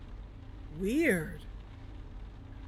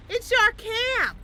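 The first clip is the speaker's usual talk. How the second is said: high, slow, delighted cry; thrilled to have found the ride; drunken excitement; 12 semitones higher, 5 dB louder, slower than usual. Acted. Group spots the taxi.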